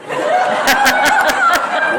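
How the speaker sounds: the room laughs loudly; a burst of crowd laughter; audience laughter. Real, not acted.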